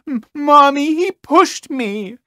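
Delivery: put-on crybaby boy telling on someone; whiny kid. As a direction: whimper like a tattling little kid. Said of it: "Entitled kid then goes".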